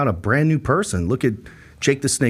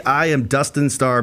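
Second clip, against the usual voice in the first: ordinary delivery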